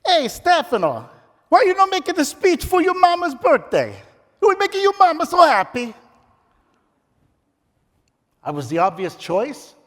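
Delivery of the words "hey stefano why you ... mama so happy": put-on high, loud Italian-accented father; Italian dad accent. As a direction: play the pushy Italian dad, broken English.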